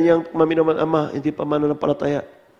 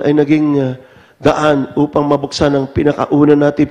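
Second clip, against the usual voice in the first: loud, raised fervent testimony; preaching at full force; 10 dB louder than usual. Acted. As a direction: preach it to the whole congregation.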